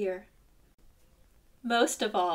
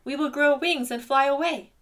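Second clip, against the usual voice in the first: higher, louder triumphant cry; ant crying out; 5 semitones higher, 8 dB louder than usual. A narrator's reading. Ant cries back at bear, higher and louder. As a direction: call it out defiantly. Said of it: "cried Ant".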